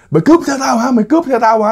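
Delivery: high-pitched mock yell at the robbers; retold yelling. Drunk man yelling high at four silent attackers. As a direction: yell it high, as if your bike is being stolen. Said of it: "ổng la bài hải".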